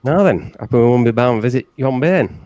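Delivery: lightly put-on broad Yorkshire; rustic hobbit in broad Yorkshire; Yorkshire accent. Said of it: "in a Yorkshire accent".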